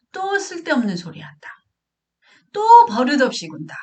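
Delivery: high-pitched imitation of a scolding parent; dismissive parent. Parent dismissing the child's words, high scolding complaint. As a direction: mimic a parent brushing off the child. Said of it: "이런 식으로 표현을 하거든요".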